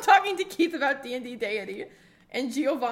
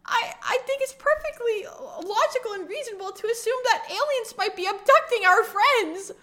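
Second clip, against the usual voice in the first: squeaky, near-tearful protest; high shaky insistent; 10 semitones higher than usual. Acted. Insane character insists.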